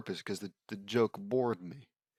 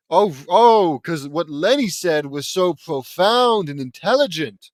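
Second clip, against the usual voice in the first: sarcastic mock awe; 4 semitones higher, 10 dB louder than usual. Real, not acted. Mocking Lenny's line.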